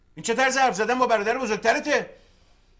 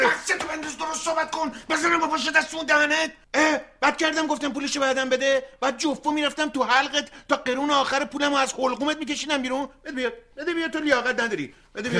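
higher-pitched angry telling-off; angry threat; 7 semitones higher than usual. Acted. Older brother berates his younger brother and snatches back the money. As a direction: threaten to slap him across the mouth.

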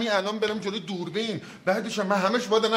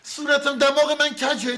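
higher, louder indignant disbelief; outraged surprise, voice jumping up; stunned vanity, much higher; shocked outrage; 7 semitones higher, 4 dB louder, slower than usual. Acted. Shocked to hear nose is crooked.

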